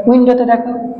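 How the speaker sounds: ordinary talk, nothing marked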